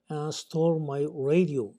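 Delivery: very slow, careful English sentence; slow example reading. Shown as a demonstration. English teacher reading an example sentence slowly to students. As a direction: read each English word slowly for learners.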